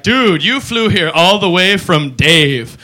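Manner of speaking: slow, drawn-out stoner dude. Retold or imitated. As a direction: drawl it like a surfer. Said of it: "We're gonna be like".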